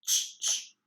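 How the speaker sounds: the bare tch sound, breathed out twice; whispered tch. Shown as a demonstration. Teacher to students, isolating the tch sound in a whisper. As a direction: hiss out "Tch, tch" as a sound model. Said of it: "o som do T C H".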